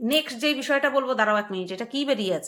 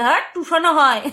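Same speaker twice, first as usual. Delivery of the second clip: higher, louder mock-insistent retort; imitating grandfather; 4 semitones higher, 7 dB louder than usual. Grandfather defends his pronunciation to grandchild, mock-insistent imitation. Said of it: "এরকম বলতো".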